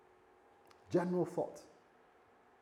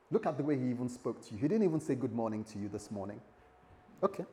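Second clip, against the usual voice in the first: ordinary delivery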